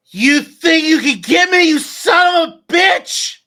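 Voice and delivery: very high, very loud furious yell; enraged villain shout. Acted. Game master as a gunman bursting in on the players, furious shout. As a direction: bellow it in outraged defiance. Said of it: "that's when you hear".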